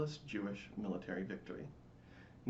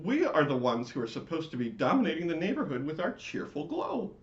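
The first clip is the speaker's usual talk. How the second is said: high, loud, indignant chorus voice; fussy neighborhood lights; annoyed lights; 6 semitones higher, 6 dB louder than usual. Acted. The lights scolding the screaming latke, high and indignant. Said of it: "said the lights in unison".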